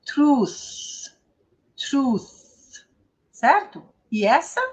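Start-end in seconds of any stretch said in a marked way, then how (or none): 0.0-2.8 "Truth" with long u and hissed final th; isolated, falling teacher's model of "Truth"; pronunciation demo. Shown as a demonstration. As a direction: draw out "Truth", blowing the th at the end. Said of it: "Como é que eu pronuncio mesmo".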